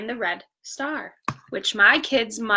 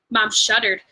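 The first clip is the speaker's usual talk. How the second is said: usual speaking voice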